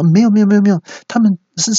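high, rushed panicky denial; quick, high backpedalling; hurried explanation. Acted. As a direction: fire off denials as an acted flustered husband. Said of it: "先生赶快解释说".

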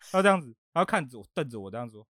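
usual speaking voice

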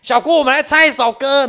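put-on high, loud drunken hollering; 醉汉乱叫. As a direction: drunk friend yelling to sing. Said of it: "在那边乱叫". (醉醺醺地高声乱叫)

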